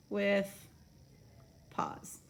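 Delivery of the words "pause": whisper. Real, not acted. Whispering the rhyme word.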